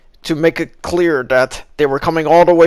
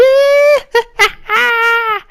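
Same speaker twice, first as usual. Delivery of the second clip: high villain cackle; evil triumphant laugh; maniacal laugh. Pretend. Maniacal laugh mid rant.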